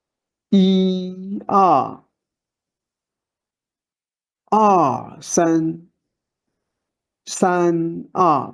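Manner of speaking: "一" punched strong and high, "二" weaker after it; first word stressed. Shown as a demonstration. Teacher showing two-word stress on "一二" to learners. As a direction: lean hard on the first syllable of each pair. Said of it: "Emphasize the first word".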